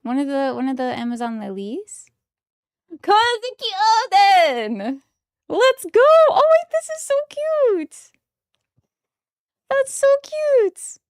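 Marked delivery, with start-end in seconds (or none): 3.0-11.0 high, loud, drawn-out delight; excited squeal. Real, not acted. Fan opening a card pack, squealing with delight. Thrilled pulling favourite card.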